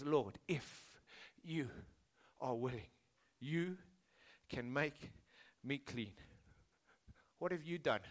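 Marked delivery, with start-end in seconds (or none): 0.0-6.1 soft, slow broken pleading; humble plea. Retold or imitated. The leper begging Jesus, softly pleading. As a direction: beg quietly, word by word. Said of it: "He says".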